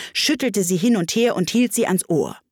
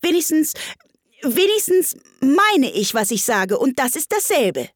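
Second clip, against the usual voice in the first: high-pitched, stumbling self-defence; higher girl character, protesting; flustered Alice; 8 semitones higher than usual. A narrator's reading. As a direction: insist while groping for the words.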